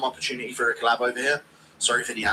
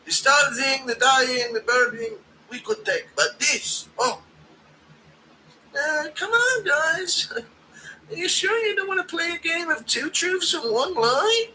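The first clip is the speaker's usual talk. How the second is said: Ian Gary impression; 12 semitones higher, slower than usual. Streamer mocking Gary irritating Palestinians, high whine. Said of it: "using Ian Gary as a tool of war".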